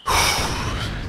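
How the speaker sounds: long, soft unvoiced exhale; deep sigh. Real, not acted. Caster trying to calm himself after a blunder, heavy sigh. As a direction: let out a real sigh to calm down. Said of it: "Need to take a deep breath there".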